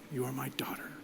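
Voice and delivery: breathy whisper to his daughter; a father whispering love to his child. Retold or imitated. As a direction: whisper it like a secret blessing. Tender words to his children.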